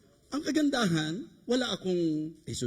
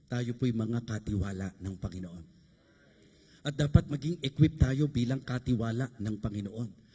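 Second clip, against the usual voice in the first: low, measured preaching voice; solemn exhortation; 8 semitones lower, 3 dB quieter than usual. Real, not acted. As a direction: drop low and charge them solemnly. (mababa at taimtim na pangaral)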